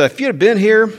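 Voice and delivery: high, loud, pleading imitation of Martha; Martha's plea. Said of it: "that was my mama talking".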